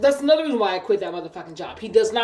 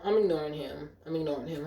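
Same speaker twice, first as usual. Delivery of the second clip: repeats it softer and softer; fading quieter each time; fading to a mutter; 8 semitones lower, 8 dB quieter than usual. Retold or imitated. To herself while he rants, fading to a mutter.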